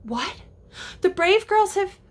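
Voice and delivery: put-on sudden high rise on girls; surprised reaction. Shown as a demonstration. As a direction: react as if startled by the news. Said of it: "change and react to what Tink said".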